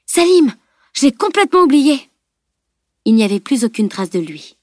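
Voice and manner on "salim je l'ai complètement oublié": high, raised cry of sudden realisation; dismayed exclamation. A narrator's reading. Camille realises she forgot Salim, crying out. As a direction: exclaim in alarm at your own forgetfulness. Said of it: "s'exclama alors Camille".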